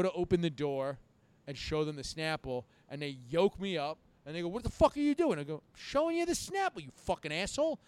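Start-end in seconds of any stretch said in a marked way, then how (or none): 4.5-5.4 high, loud outburst from a retold cop; acted angry cop. Retelling cops grabbing him. 5.7-7.8 high-pitched exasperated retort, retold; acted indignant protest. Retelling his drunken protest to cops.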